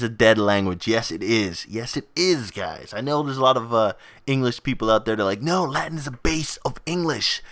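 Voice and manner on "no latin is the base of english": higher-pitched mimicked protest; mock objection. English people arguing about Latin, mock protesting. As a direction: imitate someone insisting on a correction.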